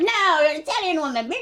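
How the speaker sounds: high-pitched mocking imitation of an upset boyfriend; hammed-up retold objection. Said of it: "he got all upset".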